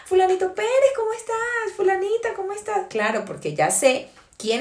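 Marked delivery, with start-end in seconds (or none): 0.0-2.9 high, bright surprised hello to a friend; cheerful phone greeting. Retold or imitated. Preacher acting out recognizing a caller, high and delighted. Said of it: "Entonces ya yo digo".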